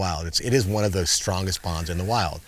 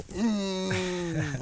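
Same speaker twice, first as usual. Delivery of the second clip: mock whimper; 12 semitones higher, 6 dB quieter than usual. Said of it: "he would go".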